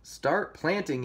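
a storybook yell, held back in volume; mild yell. A narrator's reading. Third pig ordering the others, mild yell. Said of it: "yelled the third little pig".

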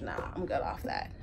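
low breathy voice for the dog; dog's voice. Pretend. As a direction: speak for the dog, low and unvoiced. Voicing the dog.

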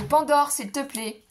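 sharp call to stop her; calling the dog. Real, not acted. Owner scolding her dog Pandore mid-video, loud call.

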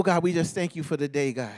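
usual speaking voice

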